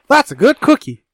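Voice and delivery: high, loud cooing praise; pet praise voice. Pretend. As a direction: coo it like praising a dog. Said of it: "That's my catchphrase now".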